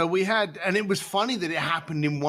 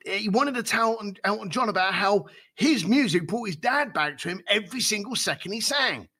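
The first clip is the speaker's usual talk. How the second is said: ordinary delivery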